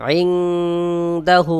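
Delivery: আইনদাহু drawn out very slowly, the ন hidden and nasal before দ; teacher models আইনদাহু in slow recitation; ikhfa demo. Shown as a demonstration. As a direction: recite আইনদাহু slowly and hide the nun in the nose. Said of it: "যেমন".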